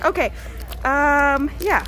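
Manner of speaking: ordinary talk, nothing marked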